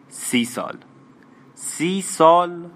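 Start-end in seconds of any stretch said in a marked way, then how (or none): 1.5-2.7 high, loud, very drawn-out astonished question; incredulous. Acted. Régine echoes the man's thirty years, incredulous. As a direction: repeat it slowly in raised, stunned disbelief. Astonished echo of his answer.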